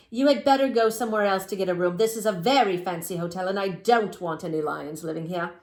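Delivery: loud, haughty clerk; snooty hotel clerk. Acted. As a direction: look down your nose.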